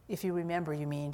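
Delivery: usual speaking voice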